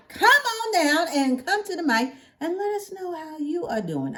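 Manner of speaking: ordinary talk, nothing marked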